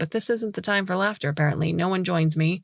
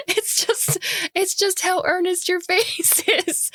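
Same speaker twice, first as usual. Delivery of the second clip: high, slow words broken by real laughter; laughing through words; 13 semitones higher, slower than usual. Real, not acted. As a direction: talk while cracking up. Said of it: "I laugh again".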